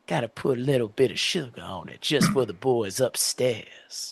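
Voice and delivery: a slick Southern politician's Southern accent, played lightly. Podcaster mimics the show's Southern politician, light Southern accent. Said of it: "Southern accent".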